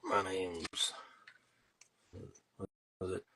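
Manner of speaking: lightly voiced Sling Blade, ending in a slow whisper; a hint of the Sling Blade character, drifting into a whisper; Billy Bob Thornton impression. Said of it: "That's my imitation".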